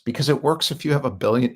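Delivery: ordinary delivery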